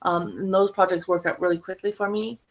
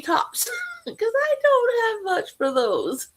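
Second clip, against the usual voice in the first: laughing; slower than usual. Real, not acted. Laughing at her own yarn shortage.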